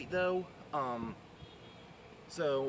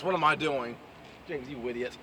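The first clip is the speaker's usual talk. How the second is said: ordinary delivery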